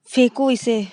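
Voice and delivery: sharp, loud order; angry command. Acted. Girlfriend angrily ordering her to throw the cigarette.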